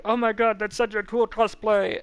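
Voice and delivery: imitating starstruck fans; a playful imitation of fans gushing; mock excited gush. Retold people excited by cosplay.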